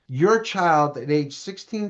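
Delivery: ordinary talk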